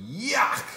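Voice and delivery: high loud disgusted cry of yuck. A narrator's reading. Andrew rejecting the pajamas, loud disgusted yuck. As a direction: spit out a kid's yuck.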